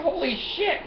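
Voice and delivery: shocked exclamation. Retold or imitated. Retelling his shock to the salesman, a high startled yelp. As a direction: squeal it like the number just hit you.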